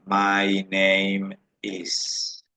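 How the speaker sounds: "My name is" stretched very slowly; patient teacher demo; very slow model. Shown as a demonstration. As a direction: draw out "My name is" syllable by syllable. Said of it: "de la siguiente manera".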